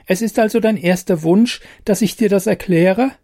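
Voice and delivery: a tiny fairy catching him out; cheeky fairy. Acted.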